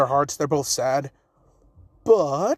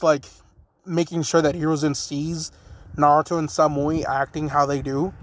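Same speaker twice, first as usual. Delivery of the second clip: ordinary delivery, nothing marked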